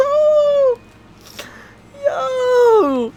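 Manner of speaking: very high, drawn-out tearful wail of joy; overjoyed fangirl squeal. Real, not acted. Fan discovering volume eighteen of Spice and Wolf, overjoyed squeal. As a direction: squeal as if about to cry happy tears.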